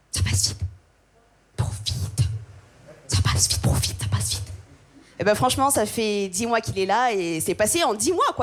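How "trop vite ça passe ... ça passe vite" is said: whispered warning. Retold or imitated. Mocking the ritual advice.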